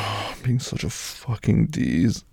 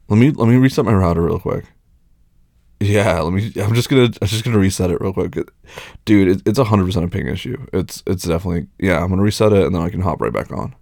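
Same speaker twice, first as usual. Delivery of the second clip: fast talking; 4 dB louder, faster than usual. Acted. Hurried excuse, gamer chat.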